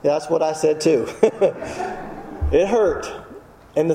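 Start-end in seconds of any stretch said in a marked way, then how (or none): 1.1-2.5 short chuckle. Real, not acted. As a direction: chuckle quietly at your own joke. Laughs at his own quip.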